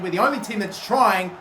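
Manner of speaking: normal talking voice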